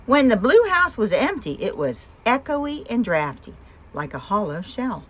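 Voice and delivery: plain reading voice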